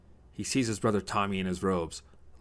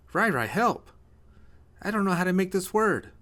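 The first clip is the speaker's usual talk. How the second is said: put-on high, loud small-child voice; little boy pleading; 12 semitones higher, 5 dB louder than usual. Little brother asks Ryan for writing help, pleading. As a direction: plead like a six-year-old stuck on homework.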